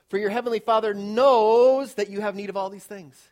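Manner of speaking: loud, ringing scripture reading; scripture read aloud. Acted. Preacher proclaiming scripture to congregation, ringing loud reading. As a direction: proclaim the verse to the church.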